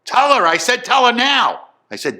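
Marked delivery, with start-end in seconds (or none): 0.1-0.9 very high, loud command; angry father's shout. Retold or imitated. Father interrupts son's phone call, angry shout. 0.9-1.7 high, loud repeated order; angry father's shout. Retold or imitated. Father presses son to end it, angry shout.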